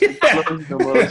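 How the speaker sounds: laughing. Real, not acted. Listing emojis, laughing.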